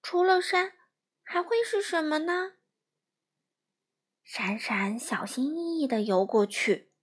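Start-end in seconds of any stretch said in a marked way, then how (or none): 0.0-2.5 high puzzled little fish voice; little fish wondering. Acted. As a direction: puzzle it out, bright and small. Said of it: "闪闪想着".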